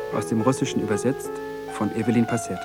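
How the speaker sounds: usual speaking voice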